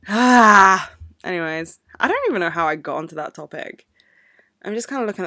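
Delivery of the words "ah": loud sighed "ah"; noisy venting sigh; exasperated sigh. Real, not acted. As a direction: let out a big, loud exhale. Venting after the rant.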